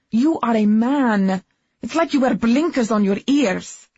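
loud, furious shouting; angry shouting. Acted. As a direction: rage at him at full volume. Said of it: "a woman screaming".

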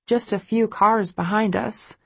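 plain reading voice

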